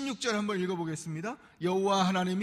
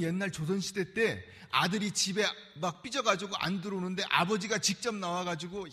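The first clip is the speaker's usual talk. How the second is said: ordinary talk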